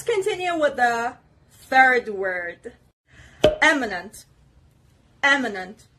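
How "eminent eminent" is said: "Eminent" with a firm hit on the first syllable; careful teacher's "Eminent", stressed at the start. Shown as a demonstration. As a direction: model "Eminent" clearly, leaning on the opening E. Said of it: "The stress is obviously on the first syllable".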